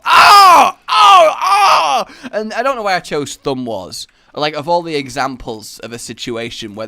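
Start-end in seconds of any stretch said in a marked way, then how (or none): 0.1-2.1 loud, high yelps; screaming. Acted. Thumb war challenger suddenly attacking, screaming. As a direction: yell out in short high bursts. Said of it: "and the screaming".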